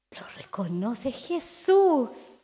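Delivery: slow, breathless whisper. Acted. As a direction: hush it like a secret dawning. Hushed moment of recognition.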